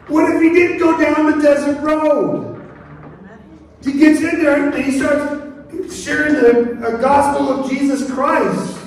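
high, loud, impassioned sermon voice; full-force preaching. Real, not acted. Preacher proclaims Philip's obedience on the desert road, full-force preaching. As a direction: proclaim it to the whole congregation.

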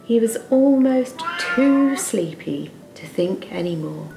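ordinary delivery

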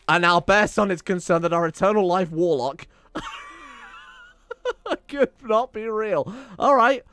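rising, half-laughing outrage at the bear son; incredulous excitement. Real, not acted. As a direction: pitch climbs high, laughing disbelief.

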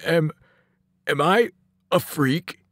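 halting, sobbing question; big kid's hurt, broken question; tearful. A narrator's reading. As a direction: stammer it out through tears.

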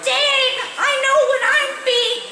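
high, bright-eyed little bunny, pleased with itself; tiny bunny voice. Acted. Little bunny Sword answering Kristoff's riddle, squeaky and proud.

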